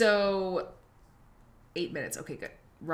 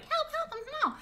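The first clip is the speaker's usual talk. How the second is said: even higher squeaky cry for help; pitched-up tiny voice. Pretend. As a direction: push the tiny voice higher still. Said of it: "pitch my voice up".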